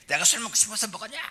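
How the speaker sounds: put-on high, snapping drunk husband's retort; angry husband. Husband snapping back at his nagging wife, angry. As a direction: fire back defensively. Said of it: "막 성질 내면 지도 성질을 내는데".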